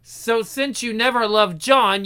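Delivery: usual speaking voice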